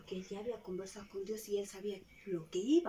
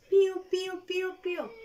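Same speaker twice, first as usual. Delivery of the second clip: very high, loud pío pío; squeaky baby chick; chick peeping; 9 semitones higher, 10 dB louder than usual. Acted. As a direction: peep like a tiny chick. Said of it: "El pollito dice así".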